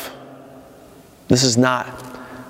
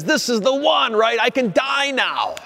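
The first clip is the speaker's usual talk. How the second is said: awed relief; 10 semitones higher than usual. Retold or imitated. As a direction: say it like he's finally at peace, lightly voiced. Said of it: "Simeon says".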